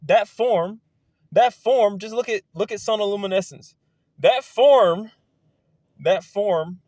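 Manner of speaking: loud, high, fervent repetition; real mounting excitement over a discovery; excited emphasis. Real, not acted. Excited rant about a revelation.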